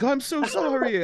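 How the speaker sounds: high, slow apology; apologetic mother. Retold or imitated. Mother to fake cop, apologetic. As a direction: imitate a mortified mom, drawing it out high.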